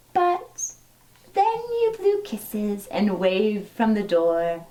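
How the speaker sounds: gentle, lilting storybook read-aloud; reading to children. Acted. Teacher reading a dinosaur story to her class, storybook lilt. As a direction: read it like a bedtime picture book. Reads a picture book to children.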